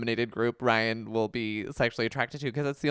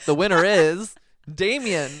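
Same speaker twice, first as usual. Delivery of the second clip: slow, louder, higher prize-show announcement; announcer; 4 semitones higher, 6 dB louder, slower than usual. Pretend. Co-host announcing a mock award, slow and grand.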